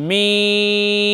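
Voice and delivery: মিম with a very long held vowel; lips-together articulation. Shown as a demonstration. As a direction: draw মিম out on one level note. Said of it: "দুই ঠোঁটের শুকনা স্থানে লাগিয়ে উচ্চারণ করতে হবে".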